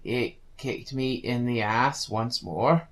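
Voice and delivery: ordinary talk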